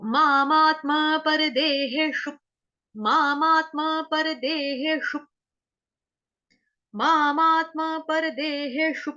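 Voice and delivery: मामात्मा said slowly with a rise, syllables drawn out; slow recitation. Shown as a demonstration. Teacher showing the class, slow chant. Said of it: "मामा के ऊपर आघात आ जाएगा".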